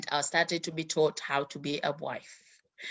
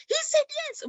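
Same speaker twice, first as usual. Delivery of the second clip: much higher, thrilled shriek of joy; excited aunt; faster than usual. Retold or imitated. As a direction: squeal the big news. Aunt repeats the news.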